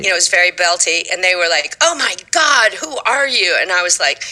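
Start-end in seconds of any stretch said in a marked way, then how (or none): 1.8-3.6 high-pitched mock astonishment; amazed auditioners. Auditioners reacting to a belted audition song, amazed. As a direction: gush in disbelief like a wowed panel.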